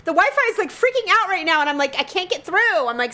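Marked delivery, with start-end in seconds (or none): 0.0-1.6 high-pitched, exasperated explaining; retold yelling tirade. Wife explaining the bad connection to her husband, retold exasperated yelling. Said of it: "diatribe of me yelling at everyone". 2.0-2.9 higher, exasperated complaint; retold yelling tirade. Wife complaining to her husband mid-meeting, retold exasperation. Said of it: "diatribe of me yelling at everyone".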